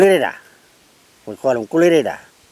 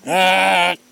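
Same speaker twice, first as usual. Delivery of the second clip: long, raised single squawks; 4 semitones higher than usual. Retold or imitated. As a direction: hold each cockatoo squawk, higher than speech. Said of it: "He go".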